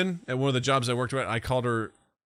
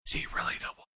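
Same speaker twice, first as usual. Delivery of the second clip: hushed, voiceless whisper; whispered inner monologue; 8 dB quieter, much faster than usual. Retold or imitated. Co-host mimicking film characters' inner monologue, whispered. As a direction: whisper it like a secret thought. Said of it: "whispering their inner monologue".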